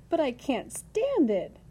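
high, slow cry of protest; distressed shout. A narrator's reading. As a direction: stretch it out in anguished protest. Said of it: "shouted Wilbur".

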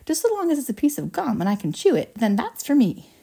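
put-on loud, keen girl's declaration; shouting. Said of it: "shouted Violet Beauregarde".

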